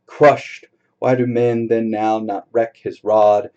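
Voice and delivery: forceful dramatic poetry reading; poem recital. Acted. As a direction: proclaim it like a sermon. Reads Hopkins aloud.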